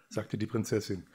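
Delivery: ordinary delivery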